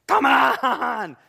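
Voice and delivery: drawn-out, high exasperated shout; exasperated yell. Retold or imitated. Customer stuck at the self-checkout, exasperated yell. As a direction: yell it out at the end of your patience.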